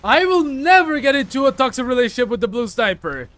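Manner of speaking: loud, insistent denial; emphatic refusal. Real, not acted. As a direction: shout it down, firmly. Refusing chat's suggestion loudly.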